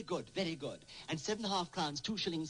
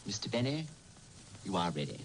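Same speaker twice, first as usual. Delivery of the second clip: solemn verdict; 7 semitones lower than usual. Acted.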